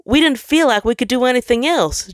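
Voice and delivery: high, loud defensive protest. Acted. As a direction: justify yourself in a rush. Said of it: "tried to defend himself".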